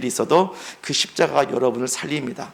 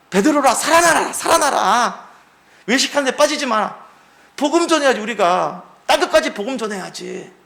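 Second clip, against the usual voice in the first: higher, pressing plea to rise; urgent exhortation; 7 semitones higher than usual. Acted. Paul urging Peter to rise and preach, higher fervent call. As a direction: urge him back to life.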